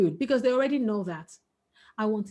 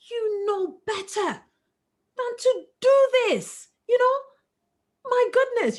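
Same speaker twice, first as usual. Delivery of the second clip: put-on high, loud, pained scolding of a child; a mother who can't believe her child did this, scolding; disappointed parent; 12 semitones higher, 6 dB louder than usual. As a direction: let the hurt of a let-down mother ring out. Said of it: "my children will know I am disappointed".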